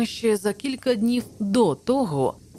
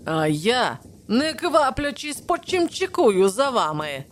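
higher-pitched, emphatic offended retort; huffy sarcasm; 6 semitones higher, 4 dB louder than usual. A narrator's reading. Challenger refuses to walk at the head, in offended sarcasm. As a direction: sound put out, climbing in pitch as it goes. Challenger angrily renounces leading the party.

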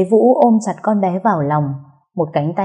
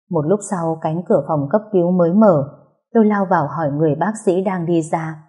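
ordinary delivery

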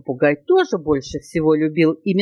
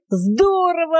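high-pitched happy exclamation; delighted monkey; 11 semitones higher, slower than usual. A narrator's reading. Monkey hearing about multiplication, delighted. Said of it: "обрадовалась мартышка".

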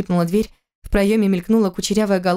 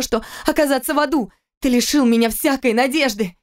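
raised, furious pitch; shaking with anger; 4 semitones higher than usual. Acted. Wife raging at husband over the children. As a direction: tremble with rage at him. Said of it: "затряслась от злости".

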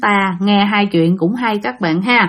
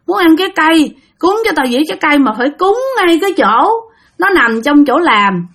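put-on higher, growling, menacing old crone voice; fierce old woman ghost; 6 semitones higher, 3 dB louder than usual. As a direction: snarl demands as a possessing old woman spirit. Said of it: "giọng của một bà già ... giọng gằn gằn rất là hung dữ".

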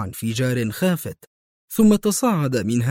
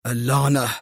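restrained, frustrated curse; understated angry oath; exclaimed curse. A narrator's reading. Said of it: "هتف برنارد".

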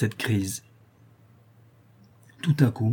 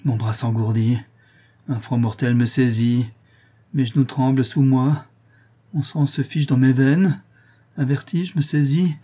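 alarm building, pitch climbing line by line; rising alarm; 3 semitones higher, 3 dB louder than usual. Acted. Poisoned man feels himself dying, alarm building steadily. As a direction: dread mounting as the poison takes hold.

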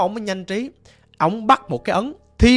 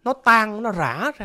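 ordinary delivery, nothing marked

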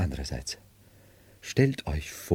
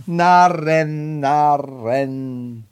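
loud, higher cry of contempt; scornful outburst; 6 semitones higher, 6 dB louder, much slower than usual. Acted. Madman alone scornfully crying out at the departed fools. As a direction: fling the word out in scorn.

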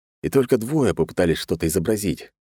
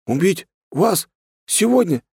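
high, bewildered one-word questions; uncomprehending babble; 14 semitones higher, 3 dB louder than usual. A narrator's reading. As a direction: echo each word in high-pitched confusion. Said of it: "непонимающе пролепетал скрипач". (непонимающе лепетать высоким голосом)

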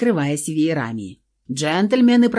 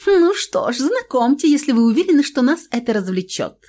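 affected; 7 semitones higher than usual. Acted. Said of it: "жеманно".

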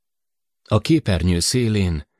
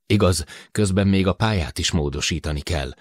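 plain reading voice

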